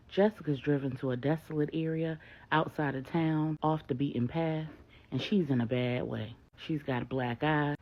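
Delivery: ordinary delivery, nothing marked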